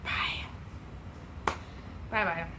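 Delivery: ordinary delivery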